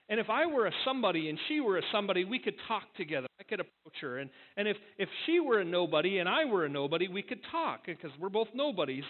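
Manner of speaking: mock-anxious Charlie Brown rattling off what-ifs; frantic lament.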